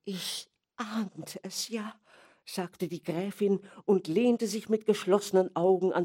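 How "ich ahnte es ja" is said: soft, slow, weary grande dame; imperious plummy grande dame. Acted. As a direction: sigh it out with closed eyes. Countess receives the bad news. (müde, leise Grande Dame)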